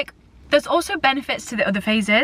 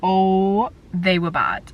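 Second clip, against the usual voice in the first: ordinary talk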